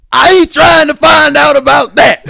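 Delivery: high, slow, indignant shout; hammed-up furious teacher; Steve Harvey. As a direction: sputter then bellow in mock outrage. Harvey scolding a student in a bit.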